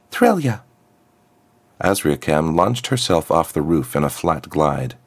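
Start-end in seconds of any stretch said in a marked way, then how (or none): 0.1-0.6 put-on much higher, lost girl's voice; uncertain girl. Freed girl wonders where she can go, high and unsure. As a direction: sound resolved, then lost.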